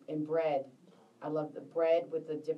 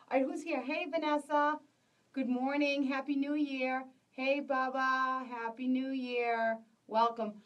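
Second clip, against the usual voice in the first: higher, bright welcome to arriving viewers, slowing on the names; upbeat higher hello, unhurried; cheerful greeting; 7 semitones higher, 3 dB louder than usual. Real, not acted. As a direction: greet each one as they join. Greeting viewers joining the stream.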